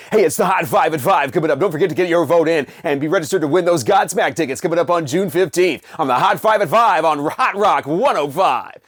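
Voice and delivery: fast, pushed hype DJ voice over music; cranked-up rock radio promo; radio announcer read. Shown as a demonstration. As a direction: punch it like an overdriven FM jock. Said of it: "everything sounds like this and it's just really cranked up".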